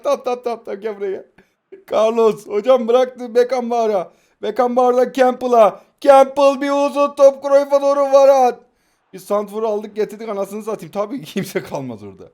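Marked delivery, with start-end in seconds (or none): 1.9-8.6 louder, lively match commentary; play-by-play commentary. Acted. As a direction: narrate the pass like a commentator. Mock live commentary of his play. (heyecanlı maç spikeri anlatımı)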